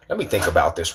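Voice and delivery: ordinary talk, nothing marked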